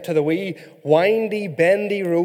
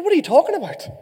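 high-pitched mock bafflement at an odd question; baffled retort; 9 semitones higher, much faster than usual. Husband answers wife's weird question, baffled retort. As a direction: jump up in pitch, acting incredulous.

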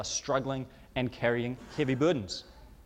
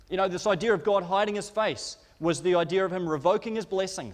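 ordinary talk

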